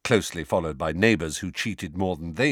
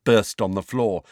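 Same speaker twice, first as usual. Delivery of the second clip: ordinary delivery, nothing marked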